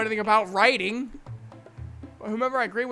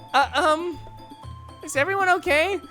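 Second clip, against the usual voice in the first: high-pitched, uneasy interjection; worried Sayori; 6 semitones higher, 4 dB louder than usual. Acted. Sayori checking on quarrelling friends, worried. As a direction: pitch it high, hesitant and concerned.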